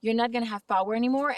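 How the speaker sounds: normal talking voice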